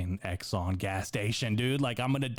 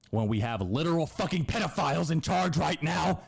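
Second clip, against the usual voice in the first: higher, pitched-up outraged rant; mock outrage at cop; 8 semitones higher than usual. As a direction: throw the question at the cop in disbelief. Ranting at imagined police officer.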